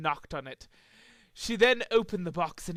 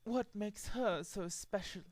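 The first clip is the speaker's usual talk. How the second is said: snooty; 2 semitones higher, 9 dB quieter than usual. Acted. Rarity sipping, unimpressed.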